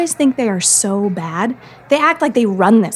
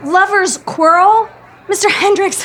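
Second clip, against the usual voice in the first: indignant disbelief; 6 semitones higher than usual. Acted. Sally indignant at Griff's claim.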